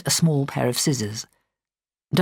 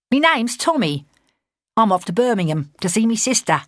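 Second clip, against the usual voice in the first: put-on Cockney accent; 7 semitones higher than usual. A narrator's reading. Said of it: "The lad had a broad Cockney accent".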